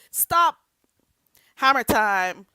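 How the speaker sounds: loud, sung-out catchphrase shout; mock hammer time. Joking about the hammer lady, loud catchphrase shout. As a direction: belt it like the old dance hit.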